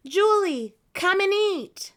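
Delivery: high, loud call down the street; mother calling across the street; calling from far away. Acted. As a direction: call her home from far off. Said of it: "someone called".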